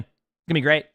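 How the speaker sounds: mock cheer about the "great" drama; sarcastic. Shown as a demonstration. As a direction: ironic "gonna be great". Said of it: "people somehow complaining".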